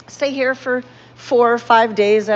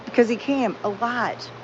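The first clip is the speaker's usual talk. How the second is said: ordinary talk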